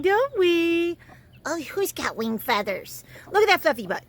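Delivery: high cooing pet voice; gushing over a fluffy chick. Real, not acted. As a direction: coo at the chicks like babies.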